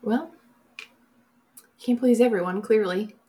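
ordinary talk

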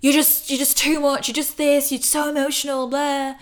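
a hint of harsh self-criticism; inner critic. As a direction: imitate your own berating inner voice. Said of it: "being like".

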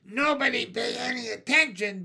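screeching witch shout, high and loud. A narrator's reading. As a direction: shriek it like a wild little witch. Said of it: "Ramona ran screaming onto the playground".

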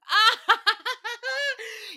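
a high, loud laugh. Real, not acted. Laughing at the poll replies, high and loud. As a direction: burst out laughing.

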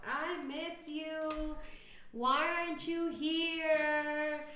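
high, slow mock sobbing wail; hammed-up drunken crying. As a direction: imitate a friend crying drunk on the phone, drawn out. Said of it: "this girl is crying her little eyeballs out".

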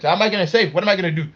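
ordinary delivery

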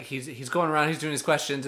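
ordinary talk

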